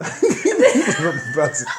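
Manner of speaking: words broken by laughter; cracking up mid-story; laughing. Real, not acted. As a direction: laugh through it. Laughing while telling story.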